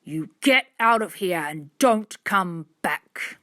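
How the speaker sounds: slow, forceful order; heavy, drawn-out angry command; angry man. A narrator's reading. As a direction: throw the kids out, word by word. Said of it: "The man was very red in the face".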